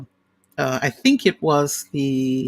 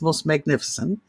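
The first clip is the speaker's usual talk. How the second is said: ordinary talk, nothing marked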